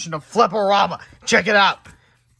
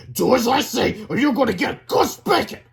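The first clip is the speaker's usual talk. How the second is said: raised, higher threatening voice; angry threat; 7 semitones higher, 6 dB louder than usual. Acted. Teacher threatening the hamster angrily. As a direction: threaten with a spanking. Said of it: "he said angrily".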